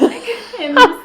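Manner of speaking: laughing mid-sentence; words breaking into giggles; real laughter while speaking. Real, not acted.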